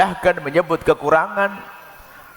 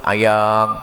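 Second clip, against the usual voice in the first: sweet pet name; 7 semitones lower than usual. Retold or imitated. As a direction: coo the pet name lovingly. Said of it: "pakai kalimat lembut".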